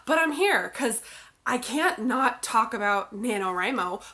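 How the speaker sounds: usual speaking voice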